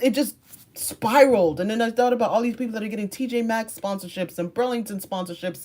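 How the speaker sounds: normal talking voice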